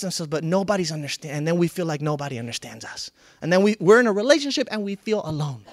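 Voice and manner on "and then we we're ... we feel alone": usual speaking voice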